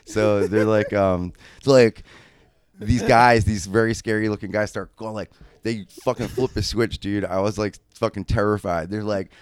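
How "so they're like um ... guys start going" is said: usual speaking voice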